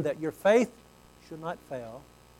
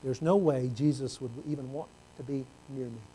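inner thought; 6 semitones lower, 9 dB quieter than usual. Retold or imitated. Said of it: "I'm thinking in Peter's heart".

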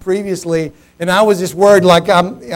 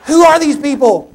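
high, loud exasperated outburst; retold angry disbelief; indignant; 9 semitones higher, 9 dB louder than usual. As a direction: demand it in outrage. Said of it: "You know, I'm thinking about".

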